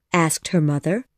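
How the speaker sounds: plain reading voice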